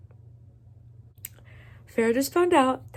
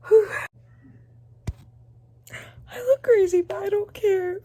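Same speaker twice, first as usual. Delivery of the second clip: giddy; 11 semitones higher, 5 dB louder than usual. Real, not acted. Still emotional, high.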